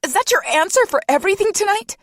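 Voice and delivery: furious mother screeching at friend; screeched. A narrator's reading. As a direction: shriek it back at him. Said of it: "she screeched".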